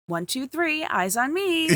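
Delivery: high, sing-song classroom call; calling a class to attention; kindergarten teacher voice. Retold or imitated. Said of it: "I would love to see them be like".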